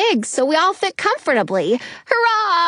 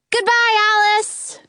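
put-on pitched-up child's farewell shout; high shouted goodbye; 9 semitones higher than usual. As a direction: call goodbye high and bright. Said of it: "we shout".

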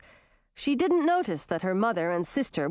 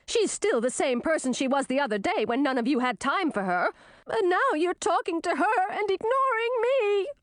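indignant princess, climbing much higher; jealous complaint; 8 semitones higher than usual. A narrator's reading. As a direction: build to a high, wounded whine.

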